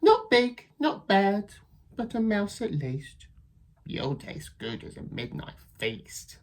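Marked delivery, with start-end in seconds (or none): 3.8-6.4 soft, hungry threat; menacing whisper. Acted. Child threatening the mouse, whispered. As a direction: whisper like a predator.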